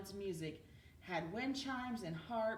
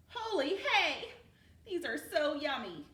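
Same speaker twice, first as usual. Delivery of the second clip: loud, high delight at a bite; delighted exclaim; 6 semitones higher, 5 dB louder than usual. Acted. Cornelius tasting Tilly's new donuts, loud delight. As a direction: savour it out loud.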